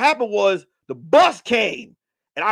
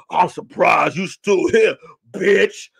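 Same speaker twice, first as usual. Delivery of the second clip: put-on low, slow tough-girl threat; imitated menace; 4 semitones lower, much slower than usual. Donna turning round on the narrator, imitated menace. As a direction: act out a slow, lowered taunt.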